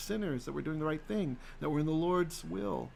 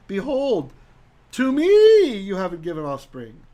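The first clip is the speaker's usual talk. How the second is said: emphatic reading; 4 semitones higher, 13 dB louder than usual. Acted. Reading Abram's lament aloud.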